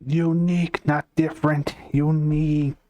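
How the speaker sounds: UNIQUE said high and drawn out, set against different; insistent emphasis. Real, not acted. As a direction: pitch up and lean hard on Unique. Insists on being unique, not different.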